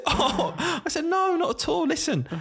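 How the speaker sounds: very high, cooing refusal; retold touched reassurance. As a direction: coo it, gently turning down a child's gift. Touched by child's gift offer.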